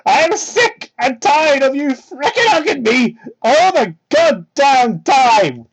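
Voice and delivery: loud, high and slow hammed-up rant; mock explosion. Sonic finally snapping at Amy, slow hammed-up yell. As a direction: drag out the anger like a soap opera. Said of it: "he just exploded".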